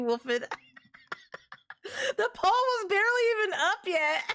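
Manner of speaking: high, loud, laughing disbelief; laughing outrage. Real, not acted. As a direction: yell it while cracking up. Outraged at fast poll vote.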